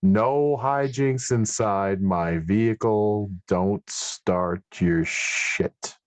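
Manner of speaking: slow, drawn-out reprimand; stern scolding. Acted. Driver warns passengers against hijinks, slow stern scolding. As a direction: stretch every word as a warning.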